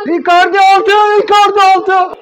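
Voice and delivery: high, loud excited shout. Real, not acted. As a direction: shout it out, thrilled. Fan screams at sixth goal.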